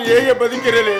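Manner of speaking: anguished cry. Acted. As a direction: break out in grief. Widower's cry of despair. (ದುಃಖದಿಂದ ಜೋರಾಗಿ ಕೂಗುವ ಗಂಡ)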